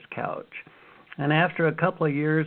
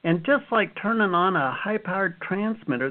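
ordinary talk, nothing marked

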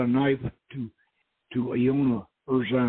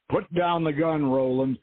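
raised-pitch order from a gunman; firm command; 6 semitones higher than usual. A narrator's reading. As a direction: give an order that expects obedience. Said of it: "the voice commanded".

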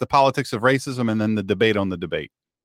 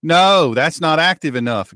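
higher, louder Southern "No" easing off; hammed-up Southern objection; Southern accent; 4 semitones higher, 4 dB louder than usual. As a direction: quote a friend in a put-on Southern accent. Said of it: "don't talk like that with a Southern accent".

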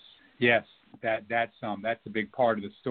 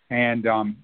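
ordinary talk

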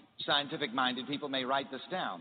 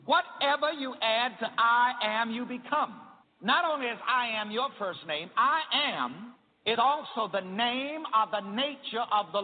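rising, louder preacher's cadence; preaching; 7 semitones higher, 3 dB louder than usual. Acted. Preacher teaching his church, in full sermon cadence. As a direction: preach it up to the congregation.

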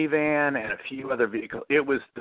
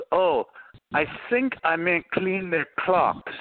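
put-on higher, drawn-out French accent; heavy French accent; 6 semitones higher, slower than usual. French engineer correcting his slip to the executives, heavy French accent. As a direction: imitate a Frenchman slowly correcting himself in English. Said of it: "in his heavy French accent".

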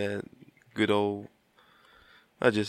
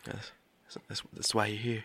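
whispering; 9 dB quieter than usual. Real, not acted. Dramatic aside to cohost.

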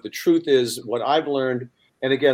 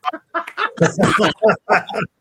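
real laugh at own joke; hearty laugh; 8 semitones higher, 4 dB louder than usual. Real, not acted. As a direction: burst out laughing.

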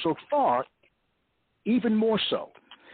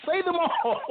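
"Say them all" repeated much higher and sing-song; higher playful urging; 12 semitones higher, slower than usual. Real, not acted. Host repeats the request to the poet higher, teasing and eager. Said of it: "laughs Okay".

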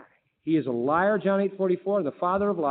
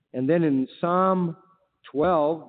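ordinary talk, nothing marked

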